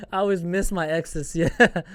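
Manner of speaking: laughing at his own slip on exes; amused at the slip, saying exes. Real, not acted. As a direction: giggle through the word exes.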